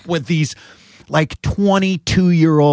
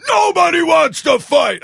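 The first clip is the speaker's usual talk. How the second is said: shouting to break it up; 12 semitones higher, slower than usual. Retold or imitated. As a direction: shout it down like a stern dad. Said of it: "And I was like".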